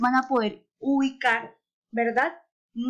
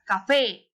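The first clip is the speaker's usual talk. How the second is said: café with a long, stressed FÉ; stress on last syllable. Shown as a demonstration. Teacher demonstrating the tonic syllable, café stressed on FÉ. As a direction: hold the fé of café with force. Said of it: "¿En dónde se encuentra?".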